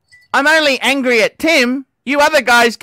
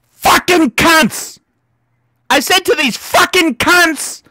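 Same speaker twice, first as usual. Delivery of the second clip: angry cursing, pitched up; pissed off; 5 semitones higher than usual. Real, not acted. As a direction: lean hard into the swear words. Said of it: "I'm fucking pissed off".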